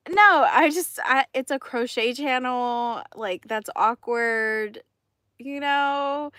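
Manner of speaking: loud, flustered "it's a crochet channel". Retold or imitated. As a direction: stumble out an awkward excuse. Flustered reply to coworker.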